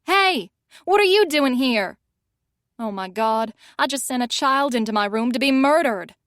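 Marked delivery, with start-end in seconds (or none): 0.0-2.0 loud, higher startled yell. Acted. Fiona yells at the stranger on the bed, loud startled yell. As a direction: shout at the intruder in the room. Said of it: "I hear her yell".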